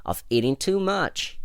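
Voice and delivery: lightly played reproach; stern. A narrator's reading. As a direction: scold with a touch of sternness. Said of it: "said Rabbit sternly".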